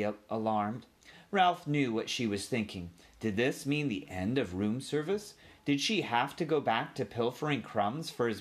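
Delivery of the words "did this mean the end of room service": ordinary delivery, nothing marked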